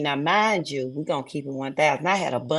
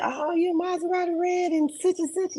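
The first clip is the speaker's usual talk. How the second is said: much higher mock holler of a starstruck fan; excited fan holler; 14 semitones higher than usual. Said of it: "she hollering".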